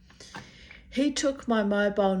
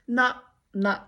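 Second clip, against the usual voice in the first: dismissive refusal; 5 semitones higher, 4 dB louder than usual. Retold or imitated. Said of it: "I was like".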